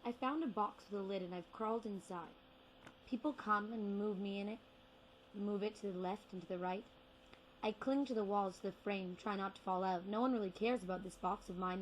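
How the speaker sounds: ordinary talk, nothing marked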